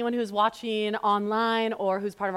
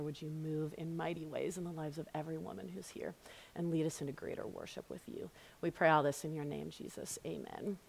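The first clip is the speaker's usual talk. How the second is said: prayer; 2 semitones lower, 6 dB quieter than usual. Real, not acted. Teacher praying for the women's group, hushed prayer voice. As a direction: pray quietly, with measured pauses. Let me pray for us.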